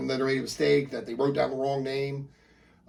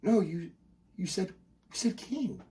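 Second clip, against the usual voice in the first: hesitant mom; 5 semitones higher, 5 dB quieter, slower than usual. Acted. Mom correcting dad hesitantly.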